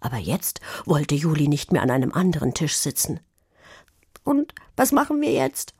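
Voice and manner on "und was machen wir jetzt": high, tentative question; unsure. A narrator's reading. Pia turns to Juli for a plan, unsure. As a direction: ask uncertainly, pitched up. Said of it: "fragte Pia unsicher".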